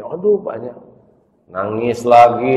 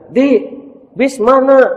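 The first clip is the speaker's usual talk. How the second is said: much higher, louder lady calling from a car; high mother voice; 8 dB louder than usual. Acted. Friend's mother calls to the boy from her car, high. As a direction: call out from the car, high and loud.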